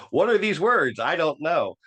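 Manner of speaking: high, loud mock bewilderment; baffled protest, played for laughs; acted exasperated bafflement. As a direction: blurt it high and loud, comically lost. Baffled by technical terms, acted out.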